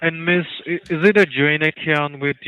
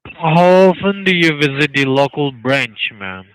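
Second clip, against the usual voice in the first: louder repeat; 8 dB louder than usual. Real, not acted. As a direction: repeat it louder, each word clear. Repeats question after "do what?.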